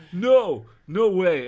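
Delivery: high, loud yelp; hammed-up frightened cry; alarmed refusal. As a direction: back down in a panic. Eric surrendering to the gunman.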